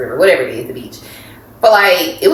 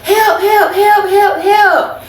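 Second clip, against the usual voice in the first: screaming help; much slower than usual. Retold or imitated. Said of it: "we all out there screaming help".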